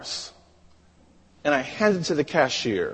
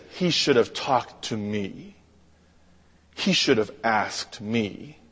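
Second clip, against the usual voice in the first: low, soft, wounded complaint; subdued voice of an offended woman; quiet reproach; 6 semitones lower, 6 dB quieter than usual. Retold or imitated. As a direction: speak low with pauses between statements.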